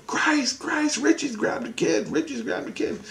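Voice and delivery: high, thin cry at no great volume; held-back mimicked scream, fading at the end; mock alarmed scream. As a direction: squeal the alarm like a tattling child. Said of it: "my innocence screams out".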